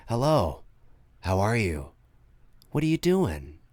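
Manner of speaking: low, soft, even phone voice; a friendly but creepy caller; low calm caller. Retold or imitated. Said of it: "he's like".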